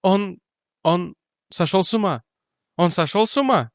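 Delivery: high, strained, squeezed out; forced out. Acted. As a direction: force the words out. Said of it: "выдавила". (выдавить через силу)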